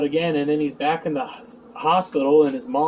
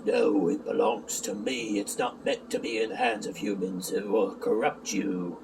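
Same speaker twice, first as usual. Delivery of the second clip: Emo; 7 semitones lower, 7 dB quieter than usual. Retold or imitated. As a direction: answer as a grave dream lord, hushed and deep. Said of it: "Emo says".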